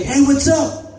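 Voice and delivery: high, chummy hello; retold eager greeting. Stranger greeting him like an old friend, retold high and eager.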